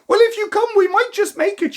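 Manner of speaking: high urgent voice. Acted. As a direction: hurry them along. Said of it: "she said".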